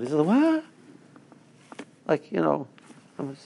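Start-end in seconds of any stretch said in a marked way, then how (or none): 0.2-0.6 high, startled what; a shocked little squeak of disbelief; seed's shocked question, much higher; surprised seed. Acted. Seed surprised at being buried.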